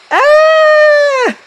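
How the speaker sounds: long, loud high-pitched scream; terrified scream. Acted. Victim attacked by the ghost, long piercing scream. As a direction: scream at full pitch in acted terror.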